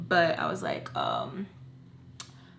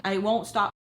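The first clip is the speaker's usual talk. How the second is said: usual speaking voice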